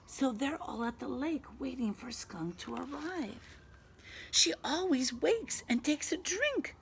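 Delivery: lively storybook reading; animated picture-book voice; reading to children. A narrator's reading. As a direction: read it brightly to a little one.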